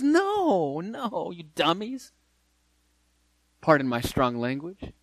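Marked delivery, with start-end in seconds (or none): 0.0-2.1 exasperated no. Acted. Paul rebuking his opponents.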